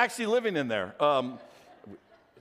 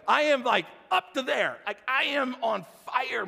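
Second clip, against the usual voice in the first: higher-pitched, hammed-up exasperation; worked-up rant; 5 semitones higher than usual. Pastor retelling his airport meltdown, mock fuming. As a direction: play being at boiling point. Acting out his boiling blood pressure.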